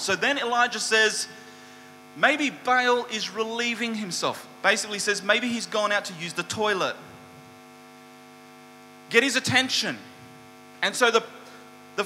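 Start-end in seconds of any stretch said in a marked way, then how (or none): 2.2-4.5 higher-pitched mocking speculation; cheeky taunt. Elijah mocking the prophets' silent god, cheekily. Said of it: "cheekily, begins to taunt them". 5.2-10.0 cheeky taunt. Retold or imitated. Elijah goading the prophets to rouse Baal, cheekily. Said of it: "cheekily, begins to taunt them".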